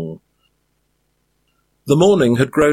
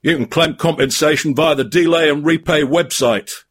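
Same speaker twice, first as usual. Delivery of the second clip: growling troll; 6 semitones higher than usual. A narrator's reading. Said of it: "growled the cave troll".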